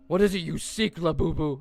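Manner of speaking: high, loud toy villain voice; menacing living toy; Labubu voice. Acted. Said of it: "Oh, it's Labubu".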